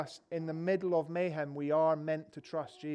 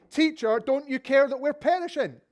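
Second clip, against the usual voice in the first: raised, urgent cry of frightened disciples; pitched up and loud, a desperate question; desperate plea; 8 semitones higher, 9 dB louder than usual. Retold or imitated. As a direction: plead with him over the storm. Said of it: "and they say".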